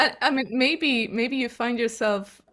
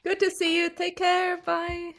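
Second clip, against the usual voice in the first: cheerful goodbye; 9 semitones higher than usual. Real, not acted. Sing-song goodbyes.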